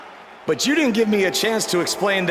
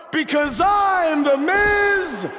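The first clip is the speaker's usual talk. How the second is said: mock Miz shout; 9 semitones higher, much slower than usual. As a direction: bellow it like a gloating heel. Mocking Miz's catchphrase to crowd.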